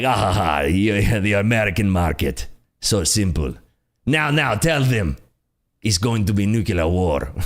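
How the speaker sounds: hammed-up Russian accent; cartoonish Russian boss voice; bad Putin accent. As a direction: play a smug Putin with a clumsy Russian accent. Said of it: "this is my Putin accent".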